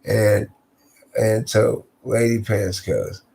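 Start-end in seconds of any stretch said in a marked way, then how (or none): none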